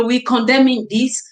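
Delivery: normal talking voice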